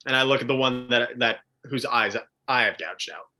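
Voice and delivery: usual speaking voice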